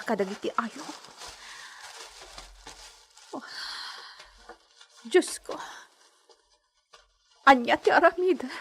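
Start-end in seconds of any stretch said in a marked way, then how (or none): 3.3-8.5 alarmed cry. Acted. Alarmed outcry after sudden news.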